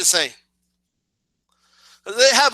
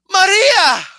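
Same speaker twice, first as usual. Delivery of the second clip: loud, high melodramatic cry; hammed-up telenovela shock, voice leaping up; shocked exclamation; 8 semitones higher, 7 dB louder than usual. Heroine turns and sees her friend, loud shocked cry.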